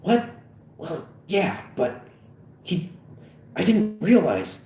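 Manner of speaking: halting, high-pitched admission; hesitant, embarrassed excuse; a little sheepish. A narrator's reading. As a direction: stammer it out sheepishly. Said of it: "says a little sheepishly".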